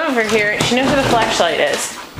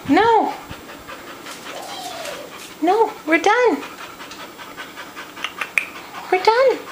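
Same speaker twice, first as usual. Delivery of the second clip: firm dog commands; 9 semitones higher, 4 dB quieter than usual. Real, not acted. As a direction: tell the dog playtime's over. Telling dog play is over.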